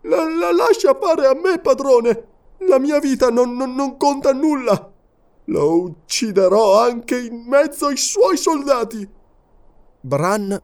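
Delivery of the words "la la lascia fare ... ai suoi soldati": stammering eager servant. A narrator's reading. Servant gripping his master's robe, stammering. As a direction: blurt it out in impatience.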